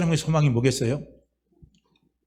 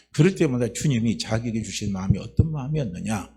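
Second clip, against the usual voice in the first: ordinary talk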